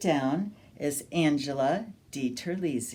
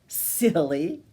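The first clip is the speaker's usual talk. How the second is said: ordinary delivery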